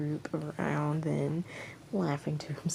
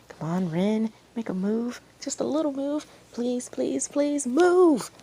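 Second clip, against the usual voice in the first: rising, louder frantic begging; desperate pleading; 7 semitones higher, 6 dB louder than usual. Acted. Watcher begging Ren to kiss back, frantic plea. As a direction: beg him to move, climbing higher.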